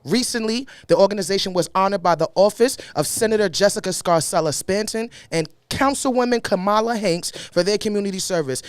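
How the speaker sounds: usual speaking voice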